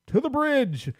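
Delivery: commanding starship captain call; Captain Picard command. Retold or imitated. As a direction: order it like a captain on the bridge. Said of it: "Captain Picard over here".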